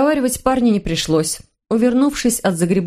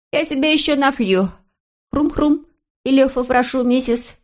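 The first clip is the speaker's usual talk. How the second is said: cocky little master of the house, higher; bossy boy; 6 semitones higher than usual. A narrator's reading. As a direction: declare it like the owner. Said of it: "по-хозяйски заявил".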